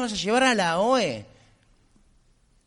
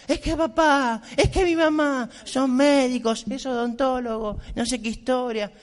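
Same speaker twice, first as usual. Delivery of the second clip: put-on higher, tearful retelling of a sobbing young woman; mock tearful girl, voice raised high; imitating a crying girl; 5 semitones higher than usual. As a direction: mimic her weepy complaint, pitched up. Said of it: "empezó a llorar".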